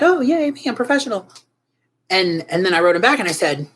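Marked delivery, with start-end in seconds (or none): 0.0-1.3 high, sing-song mock cheer; mock pride. Replying to the art director's praise, mock proud cheer. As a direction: pat yourself on the back in jest.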